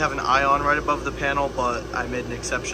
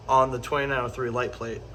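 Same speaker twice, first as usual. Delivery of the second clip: ordinary talk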